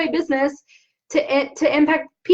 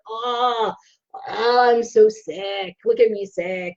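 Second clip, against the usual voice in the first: slow, lower mock-moaning of a sick patient; whiny sick patient; 4 semitones lower, slower than usual. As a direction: play the self-pitying patient lightly, stretching so sick. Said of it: "That's how I go to the doctor".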